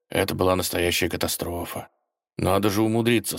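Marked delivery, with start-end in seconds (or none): none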